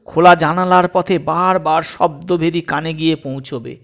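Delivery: usual speaking voice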